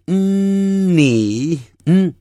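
long, high hummed 嗯 released into 你, then the hum alone; N before 你. Shown as a demonstration. Teacher isolating the standard N sound, stretched nasal 嗯. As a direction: hold the nasal 嗯 before letting 你 out. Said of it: "把这个你念出来之前".

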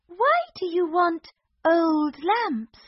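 high curious princess voice; cartoon princess. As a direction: innocent princess asking. Princess voice in story.

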